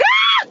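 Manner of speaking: piercing high scream; demo scream. Shown as a demonstration. Showing women how to scream at men, piercing shriek. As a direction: let out a sharp shriek. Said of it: "can we just scream".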